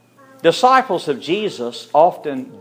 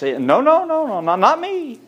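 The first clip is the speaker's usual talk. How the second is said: frantic denial; 10 semitones higher, 4 dB louder than usual. Acted. Peter denies knowing Jesus.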